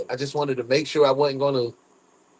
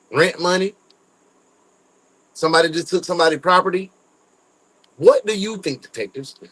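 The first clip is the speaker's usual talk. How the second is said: ordinary delivery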